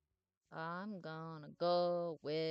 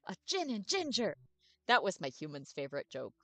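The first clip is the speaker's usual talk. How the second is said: loud, bouncy, overexcited puppy; eager dog voice; 2 semitones higher, 4 dB louder than usual. Acted. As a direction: bark every word out like a happy dog. [name] repeats his joke.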